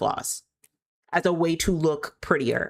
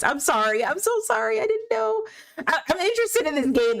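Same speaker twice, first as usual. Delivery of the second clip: much higher, flustered and rushed, sorry sorry; a startled squeaky apology to the viewers; 9 semitones higher than usual. Real, not acted. Caught off guard by screen.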